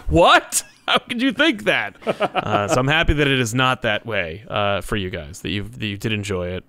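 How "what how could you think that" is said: high, laughing mock disbelief; incredulous. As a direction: act shocked at the very idea. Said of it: "And I'm like".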